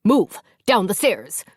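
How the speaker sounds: hurrying everyone down; urgent order; barked order. A narrator's reading. Hern ordering retreat.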